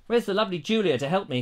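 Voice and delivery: ordinary talk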